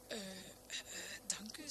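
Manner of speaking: soft, halting, with hesitant "eh"; shy. A narrator's reading. Bridegroom thanking the guests for their gift, shy. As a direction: stammer a bashful thanks. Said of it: "verlegen en hij kuchte".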